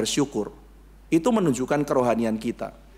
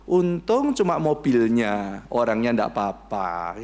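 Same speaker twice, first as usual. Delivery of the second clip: slow relieved; 5 semitones lower, slower than usual. Retold or imitated. Quoting a relieved lucky person.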